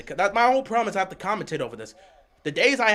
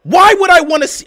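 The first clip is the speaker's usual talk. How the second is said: loud, raised-pitch fan rant; ranting yell; 6 semitones higher, 13 dB louder than usual. Real, not acted. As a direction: yell your take in exasperation. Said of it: "I'm not screaming at you".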